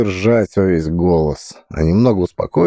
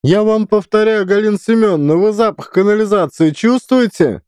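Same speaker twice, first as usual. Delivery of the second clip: shrill director; 14 semitones higher than usual. Acted. Director voiced in story.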